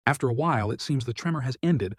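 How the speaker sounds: usual speaking voice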